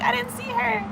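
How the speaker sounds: high, thin-volume imitation of a sister's excuse; retold defensive squeak; mock protest. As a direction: plead innocence in a very high voice.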